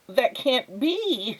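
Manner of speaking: high, slow, dazed neighbour voice; stunned disbelief. Acted. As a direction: neighbour stares at the squirrel holding the vacuum in high, slow disbelief. Neighbour repeats herself dazed.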